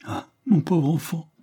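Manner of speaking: high, breathless broken whisper; a mother's stifled sob; sobbing whisper. A narrator's reading. Mother grieving for her son, whispered through sobs. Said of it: "sanglota madame Hare".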